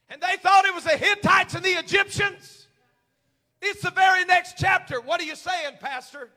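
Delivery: loud, high shouted sermon with drawn-out words; full-force preaching. Real, not acted. Preacher proclaiming fear not to the church, full-force shout. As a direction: cry it out to rouse the whole church.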